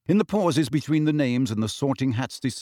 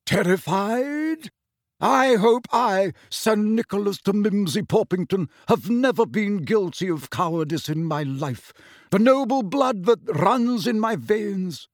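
affronted; 7 semitones higher than usual. A narrator's reading. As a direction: bristle with wounded pride. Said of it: "looked highly affronted".

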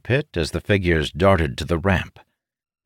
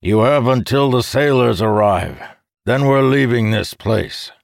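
grumbling captain; 6 semitones higher, 4 dB louder than usual. Acted. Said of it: "Calimere grumbled".

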